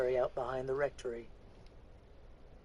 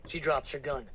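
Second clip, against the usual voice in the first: usual speaking voice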